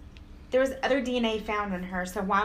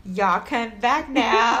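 folksy country drawl; 5 dB louder, much slower than usual. Pretend. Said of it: "You hear?".

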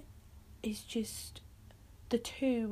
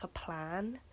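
normal talking voice

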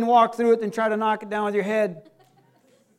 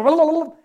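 loud, high babbled filler; rattled-off gibberish; 5 semitones higher, 5 dB louder, faster than usual. Retold or imitated. Imitating a walking Bible quoting Revelation, loud babble. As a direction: babble like reeling off verses. Mimics man cross-referencing scripture.

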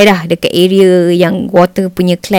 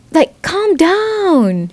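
slow, soft and higher, like calming someone; soothing calm down; 7 semitones higher, 5 dB quieter, much slower than usual. Shown as a demonstration. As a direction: ease them down gently. Showing how to calm down.

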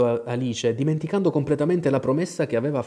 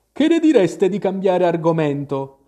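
louder, higher yawning March Hare; raised voice stretched by a yawn; 6 semitones higher, 6 dB louder than usual. A narrator's reading. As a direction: say it through a yawn. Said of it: "intervenne la lepre Marzolina sbadigliando".

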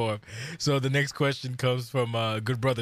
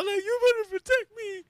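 put-on much higher, whiny child voice; scared kid. Boy begging his dad for protection, high whine. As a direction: beg like a frightened little boy.

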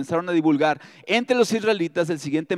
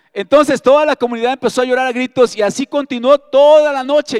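raised, high, emphatic scripture reading; loud dramatic reading; 5 semitones higher, 9 dB louder than usual. Acted. Preacher reads of a whole community crying, loud and dramatic. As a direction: read it out big and loud.

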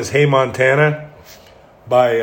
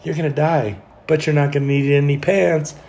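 higher-pitched mock girl demon voice; 4 semitones higher than usual. Girl demon teasing a sleeper about pants, put-on high voice. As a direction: lift the pitch to play a female demon. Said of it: "Or send a girl demon".

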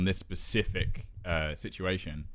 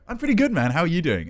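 chatty passenger; 7 semitones higher than usual. Retold or imitated.

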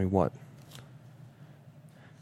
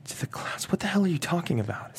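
stunned whisper; 9 dB quieter than usual. Acted. Stunned by Susan's words.

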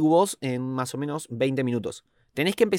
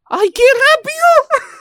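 much higher, louder startled squeak; surprised squeal; 11 dB louder, slower than usual. Real, not acted. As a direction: yelp in surprise. Reacción con efecto. (grito agudo de sorpresa)

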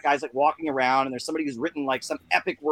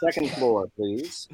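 elevator voice; 3 semitones lower, slower than usual. Pretend. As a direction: play the elevator announcer, dropping low on the last word. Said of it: "I would love to hear [name]'s voice".

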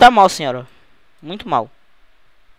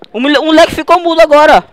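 mock alarm; 8 semitones higher, 10 dB louder than usual. Father acts alarmed, son mute.

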